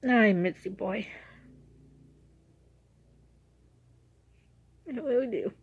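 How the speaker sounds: real tearful voice breaking; crying. Real, not acted. Owner talking to her dead dog's picture, crying. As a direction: choke up as the tears come. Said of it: "I wasn't expecting to cry in this vlog".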